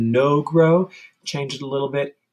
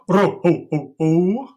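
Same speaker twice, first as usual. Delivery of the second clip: Santa ho ho ho; 5 semitones higher, 4 dB louder than usual. Shown as a demonstration. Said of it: "Santa belly".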